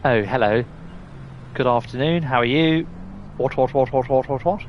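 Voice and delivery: hammed-up posh English gentleman; posh accent. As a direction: play the posh boy with plummy vowels. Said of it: "I'm a posh boy".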